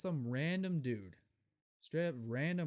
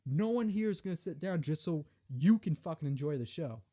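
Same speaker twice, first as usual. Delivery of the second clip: higher, louder scolding rant; retold rant; 4 semitones higher, 5 dB louder than usual. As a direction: chew out the lady with full energy. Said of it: "full energy".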